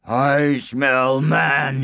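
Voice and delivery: very slow, booming giant voice; slow menacing sniff; bellowing giant. Acted. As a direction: drag it out like a hungry giant. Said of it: "he bellowed".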